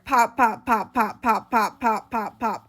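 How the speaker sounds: loud, evenly punched pops, each falling; rapid-fire string of loud pops; imitated gunshots. Imitating the gunshots heard last night.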